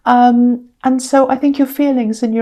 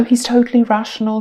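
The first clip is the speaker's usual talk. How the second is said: ordinary delivery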